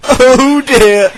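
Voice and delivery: loud, high mocking truck voice; high-pitched amused jeer; laughing trucks. A narrator's reading. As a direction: tease gleefully, pitched high. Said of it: "laughed Thomas's trucks".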